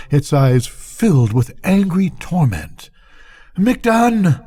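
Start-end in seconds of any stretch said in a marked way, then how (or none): 3.6-4.4 high, sharp call of a name; frightened shout for help; urgent cry. A narrator's reading. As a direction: yell it over the roar.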